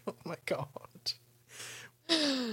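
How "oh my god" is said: whispered. Retold or imitated. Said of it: "It's like".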